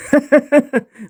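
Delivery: brief high sly laugh in the disguise voice; sly chuckle. Acted. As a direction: laugh slyly at your own joke. Disguised villain chuckles at déjà vu.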